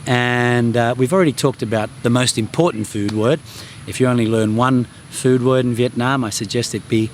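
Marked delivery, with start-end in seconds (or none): none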